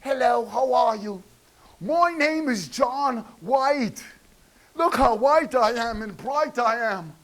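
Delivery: high, soft, prim mock-polite voice.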